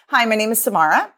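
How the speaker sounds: rising inflection on "Samara"; upspeak. Shown as a demonstration. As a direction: introduce yourself with "Samara" lifted like a question. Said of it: "upspeak is that rising inflection at the end".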